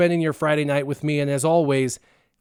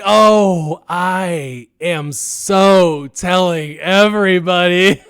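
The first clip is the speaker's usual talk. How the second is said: loud, slow, laughing gloat; gleeful teasing; 6 dB louder, much slower than usual. Retold or imitated. Gloating at his caught-out friend, loud and laughing. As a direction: drag it out, laughing at him.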